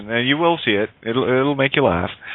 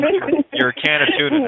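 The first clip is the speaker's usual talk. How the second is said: teasing high voice; 13 semitones higher than usual. Acted.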